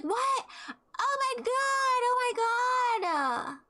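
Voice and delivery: high, drawn-out, almost wailing "oh my god"; mock awe. Mimicking a guy stunned by her YouTube views, high and slow. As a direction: ham up his starstruck disbelief, slowly. Imitating match's stunned reaction.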